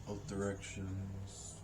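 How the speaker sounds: soft, slow guided breathing voice; soft spa whisper. Acted. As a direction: guide them gently through each breath. Leads a breathing exercise.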